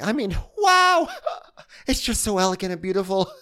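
gushing Rinna impression. Imitating Rinna gushing over the fashion show. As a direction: gush like a starstruck fan. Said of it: "Rinna's like".

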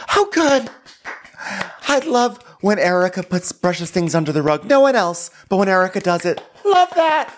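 very high, over-the-top fawning; hammed-up high-pitched admiration; mock gushing. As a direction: squeal the praise in exaggerated delight. Sarcastic spoof cheering for Erica.

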